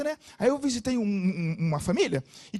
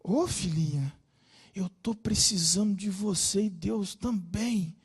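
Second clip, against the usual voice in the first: tender reassurance; slower than usual. Retold or imitated. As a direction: gently reassure her like a father. Pastor comforting the girl.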